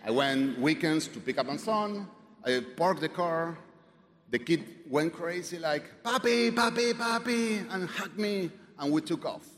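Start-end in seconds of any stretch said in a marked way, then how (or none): 6.0-7.7 put-on high, excited child calling papi; excited kid. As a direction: imitate a thrilled little kid running to dad. Child excitedly greeting his father.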